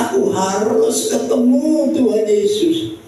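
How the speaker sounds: high-pitched, insistent resolve; determined. Retold or imitated. Preacher voicing Bartimeus set on meeting Jesus, determined. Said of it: "dia punya tekad".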